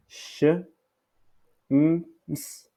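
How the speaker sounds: "Sh-, m-, s" sound by sound, long pauses between; sons décomposés. Shown as a demonstration. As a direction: isolate each sound of shims: sh... m... s. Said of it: "la correction est". (« sh... m... s » son par son)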